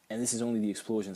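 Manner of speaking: ordinary talk, nothing marked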